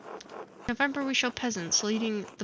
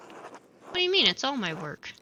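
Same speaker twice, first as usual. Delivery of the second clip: indignant; 2 semitones higher, 3 dB louder than usual. Acted. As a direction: bristle at the insult. Indignant reply to criticism.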